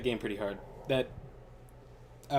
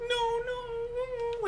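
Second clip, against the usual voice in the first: mock whimpering. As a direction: whimper like a scolded kid. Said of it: "like, whimpering".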